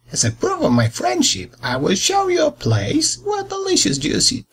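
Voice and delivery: high, sugary false-friendly wolf; sweet-talking wolf. Acted.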